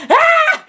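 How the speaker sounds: very high, loud excited shriek; fangirl squeal. Retold or imitated. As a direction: squeal as if you can't believe it. Reacting to being called cute.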